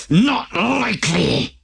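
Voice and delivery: defiant. A narrator's reading. Wolf refuses and flees.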